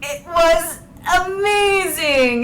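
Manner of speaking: loud, high, drawn-out rave; thrilled, laughing. Real, not acted. Streamer raving about the performance, laughing and thrilled.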